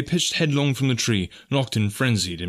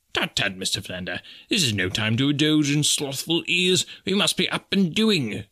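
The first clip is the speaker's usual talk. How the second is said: reproving professor; 5 semitones higher than usual. A narrator's reading. Professor scolding his prostrate companion, high and fussy.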